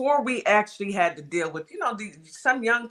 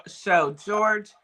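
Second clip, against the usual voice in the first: ordinary delivery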